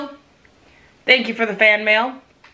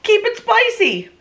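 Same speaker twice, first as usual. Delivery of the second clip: quoting Phil; 12 semitones higher, slower than usual. Retold or imitated. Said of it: "as Phil would say".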